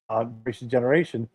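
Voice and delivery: usual speaking voice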